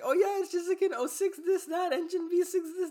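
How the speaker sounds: very high, giddy imitation of oneself; excited rattling-off. Driver telling a stranger car specs, high giddy excitement. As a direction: reel off car specs in a squeaky rush.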